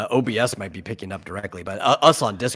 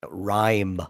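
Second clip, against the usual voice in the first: slow; 4 dB quieter, much slower than usual. Acted. Repeating the made-up word.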